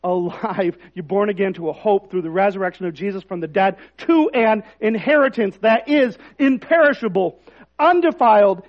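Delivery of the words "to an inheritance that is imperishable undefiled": louder proclaiming of "to an inheritance that is imperishable"; emphatic rereading. Shown as a demonstration. Pastor rereads the verse to the congregation, proclaiming it. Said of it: "I want to read that again".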